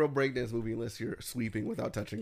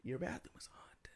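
hushed, secretive whisper; 16 dB quieter than usual. Real, not acted. Confessing he fears the bathroom.

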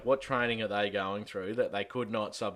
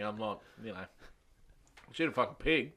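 usual speaking voice

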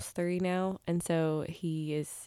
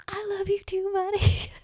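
high, sweet doting voice; mother answering her son tenderly; tender reply; 14 semitones higher than usual. Retold or imitated. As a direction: coo it back to a toddler. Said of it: "I was just like".

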